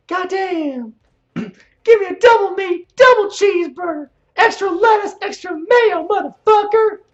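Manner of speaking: very high, loud mock-shouted impression; Bruce Pritchard. Streamer mocking a podcaster recording his impressions, high hammed-up shout. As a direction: belt it out as an over-the-top strained caricature. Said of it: "Pritchard, the bulk of his, um, impressions".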